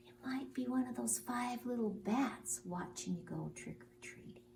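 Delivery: spooky whisper; hushed, teasing whisper. Acted.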